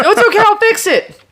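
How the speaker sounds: high, sugary imitation of the drunk girlfriend; mock sweet reassurance. Imitating the drunk girlfriend soothing her, mock sweet and high. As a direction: coo it like nothing's wrong.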